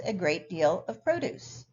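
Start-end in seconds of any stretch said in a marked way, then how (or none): 1.0-1.6 produce stressed on its first syllable. Shown as a demonstration. Teacher finishing the garden sentence, produce stressed on first syllable. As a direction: say produce as the noun, PRO-duce. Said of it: "produce is stressed on the first".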